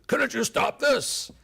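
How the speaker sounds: high, loud, drawn-out demand; exasperated demand. Retold or imitated. Speaker plays Soviet boss scolding his Warsaw chief, exasperated. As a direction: lean hard on a mock-angry question.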